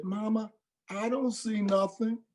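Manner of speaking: put-on high, eager little child; child's voice. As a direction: answer like a trusting small kid. Child inside trunk.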